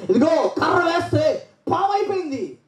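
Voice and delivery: high, lively marvel at the miracle; excited storytelling. Real, not acted. Preacher marvelling at the staff turning into a snake, high. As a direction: show the wonder in the voice.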